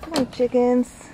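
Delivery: high coaxing call; coaxing chickens. Real, not acted. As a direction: coax them along brightly.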